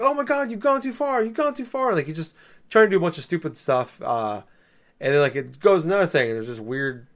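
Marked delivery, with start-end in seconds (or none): 0.0-1.9 mock shock. Game voice reacts to thrown brick.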